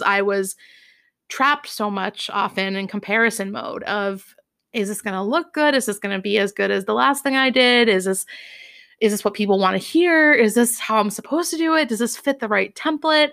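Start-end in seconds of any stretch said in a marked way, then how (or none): 4.7-13.3 fast, piled-up questions, pitch climbing at the end; relentless anxious self-talk. Retold or imitated. Speaker replaying her comparison-mode worries to listeners, relentless anxious self-talk. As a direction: rattle off doubts, building as they go. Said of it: "as you can hear in my voice, ... this talk track was relentless".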